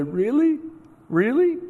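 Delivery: put-on high, rising little-boy voice; a sniffly kid daring to hope; hopeful little boy. Tearful boy asking if he won.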